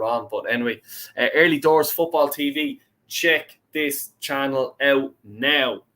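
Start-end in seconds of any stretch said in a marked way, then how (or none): 1.3-5.8 slow, word-by-word urging; deliberate, slowed-down recommendation; emphatic plug. Real, not acted. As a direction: space out each word for emphasis. Plugging a friend's channel emphatically.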